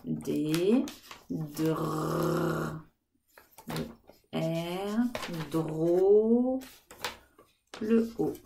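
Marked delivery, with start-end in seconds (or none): 1.3-2.8 dr with the r held long and low; sounds blended. Shown as a demonstration. Teacher sounds out dr for children, stretching the r. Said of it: "qu'est-ce que j'entends". 5.5-6.6 dro drawn out, louder, the o held long; syllable stretched. Shown as a demonstration. Teacher sounds out second syllable dro, stretched and louder. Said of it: "Deuxième syllabe".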